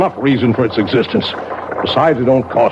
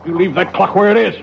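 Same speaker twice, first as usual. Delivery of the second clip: high, fierce warning; angry warning; 8 semitones higher, faster than usual. Acted. Clockmaker warning his sister-in-law off his clock, angry. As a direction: lay down the law, furious. Threatening her about the clock.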